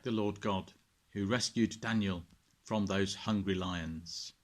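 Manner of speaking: put-on gentle storyteller reading to children; bedtime story narration. A narrator's reading. Vicar reading a Bible story to children at bedtime, gentle narration. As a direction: read it like a bedtime tale, calm and clear.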